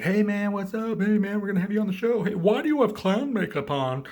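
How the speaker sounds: high, loud, chummy host; breezy greeting. Retold or imitated. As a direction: imitate an over-eager visitor. Acts out host greeting Joker.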